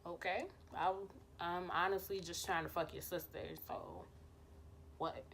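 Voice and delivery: low, soft, offhand shrug; dismissive. Retold or imitated. Telling boyfriend she only wants his sister, quiet shrug. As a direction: toss it off quietly, not caring. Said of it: "I'm like".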